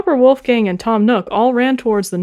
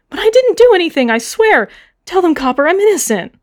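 much higher, louder, fast begging; desperate pleading; 11 semitones higher, 6 dB louder than usual. Acted. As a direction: plead your innocence in panic. Eunice pleading while arrested.

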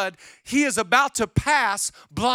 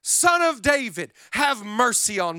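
shouted plea; 4 semitones higher, 4 dB louder, slower than usual. Retold or imitated. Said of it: "he began to shout".

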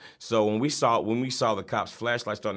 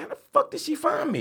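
high, incredulous voice; stunned disbelief. Retold or imitated. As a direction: ask it in total disbelief. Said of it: "I'm like".

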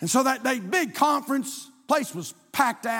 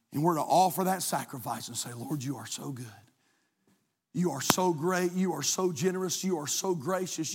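hushed prayer; 4 semitones lower than usual. Acted. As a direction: pray it low and intimate. Quiet prayerful praise.

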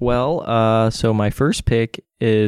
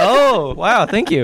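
excited thanks; 10 semitones higher, 8 dB louder, slower than usual. Pretend.

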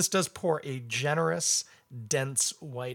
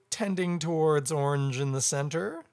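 usual speaking voice

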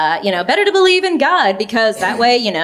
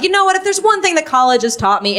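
higher, irritated comeback; annoyed; 8 semitones higher, faster than usual. Retold or imitated. Older sibling answering sister's jab about college, annoyed. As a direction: snap back at a know-it-all sibling. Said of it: "And I got annoyed".

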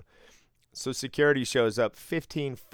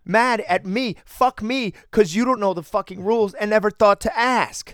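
furious retold yell, much higher and louder; snapping angry yell; 11 semitones higher, 9 dB louder than usual. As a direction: unload on the rude gambler at full shout. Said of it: "So I snapped a little bit".

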